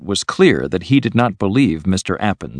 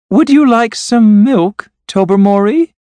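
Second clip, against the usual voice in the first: high, unnatural voice; 8 dB louder than usual. A narrator's reading. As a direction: offer it in an artificial high pitch. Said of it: "in a high, unnatural voice".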